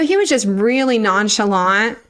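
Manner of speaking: usual speaking voice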